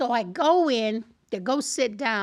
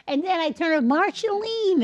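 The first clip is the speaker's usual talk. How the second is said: usual speaking voice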